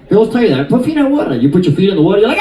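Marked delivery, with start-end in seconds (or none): 0.7-1.3 put-on high Chinese-accented instruction; put-on Chinese accent, pitched up; imitated Chinese accent. Nail lady instructing client, imitated Chinese accent. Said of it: "That's her accent".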